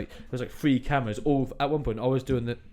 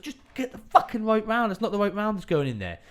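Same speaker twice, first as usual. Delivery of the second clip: high, strained mock shout at a useless helper; exasperated shout; 8 semitones higher than usual. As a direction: bark it out in mock fury. Retold self shouting at the gun crew.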